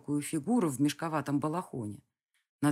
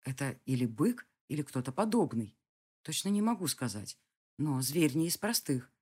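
ordinary delivery

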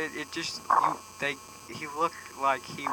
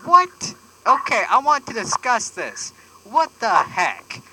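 mock angry; 9 semitones higher, 5 dB louder, slower than usual. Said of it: "Angry voice".

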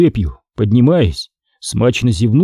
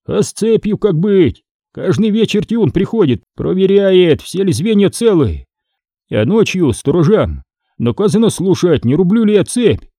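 higher-pitched lightly voiced smith, questioning; Фома, кузнец на цепи, спрашивает; 7 semitones higher than usual. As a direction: ask it in a lightly acted, lifted voice. (кузнец с вопросом, голос чуть выше)

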